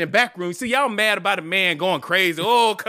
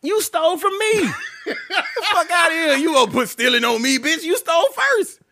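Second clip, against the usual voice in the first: heated high retort; 11 semitones higher than usual. Real, not acted. Arguing theft with his cohost, heated high retort. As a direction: fire it back at him, voice up. Arguing back about stealing.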